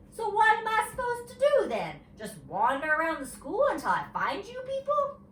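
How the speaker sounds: high, loud indignant question; indignant child. A narrator's reading. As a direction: demand it like a cross little girl.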